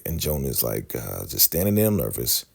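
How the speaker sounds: plain reading voice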